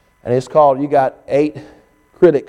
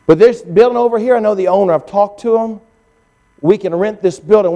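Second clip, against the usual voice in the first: higher, eager and pleading; eager plea; 6 semitones higher than usual. Retold or imitated. Church member begging the new pastor to stay, eager higher plea. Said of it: "He said".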